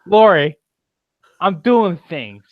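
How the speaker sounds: loud, drawn-apart words in a mock impression; Rick Grime. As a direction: act it out in separate, emphatic bursts. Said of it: "what Rick Grime is doing over there. He's saying".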